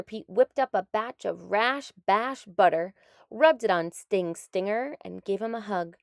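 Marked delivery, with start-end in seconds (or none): none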